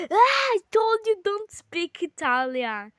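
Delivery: loud, high protest; exasperated outburst. Real, not acted. Player scolding sibling for speaking Italian, exasperated outburst.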